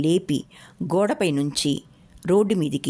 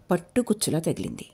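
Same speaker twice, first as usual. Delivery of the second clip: usual speaking voice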